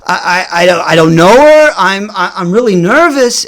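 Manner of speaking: flustered stammer with jumps in pitch; acted-out nervous stutter; fumbling words. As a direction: stumble over every start, protesting nervously. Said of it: "I'm, like, fumbling my words".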